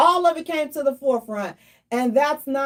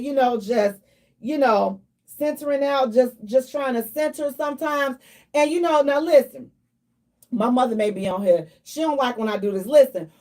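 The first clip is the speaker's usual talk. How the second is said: usual speaking voice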